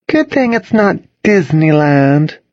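high, slow, sugary jab; sweetly said. A narrator's reading. Charlotte teasing with a glance at Toby, sweetly. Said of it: "Charlotte said sweetly".

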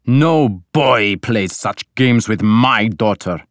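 slow, stern, controlled anger; angry. A narrator's reading. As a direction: lay down the law, slowly and hard. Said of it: "but he was angry now".

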